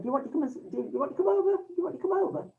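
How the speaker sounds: a hint of a shy, hesitant young man; lightly voiced nervous invitation. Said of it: "this young man looking very hesitant".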